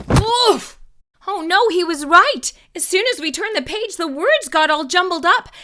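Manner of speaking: high, loud alarmed "oh no"; surprised. Acted. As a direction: burst out in shock. Book falls apart after page turn.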